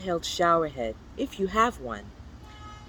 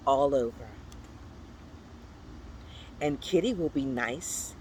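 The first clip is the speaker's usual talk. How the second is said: plain reading voice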